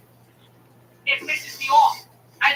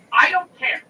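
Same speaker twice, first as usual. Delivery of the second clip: raised, higher-pitched angry retort; irate retort; 7 semitones higher, 5 dB louder, slower than usual. Retold or imitated. Caller fed up with customer service, acted irate retort. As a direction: cut the agent off with force. Acted rant at phone agent.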